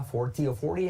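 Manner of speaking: "forty" said first with a clear T, then as American "forry" with the T dropped; two pronunciations of forty. Shown as a demonstration. Teacher showing students the British and the American "forty". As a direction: model both ways of saying "forty" for the class. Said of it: "se suele omitir la T".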